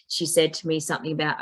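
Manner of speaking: ordinary talk, nothing marked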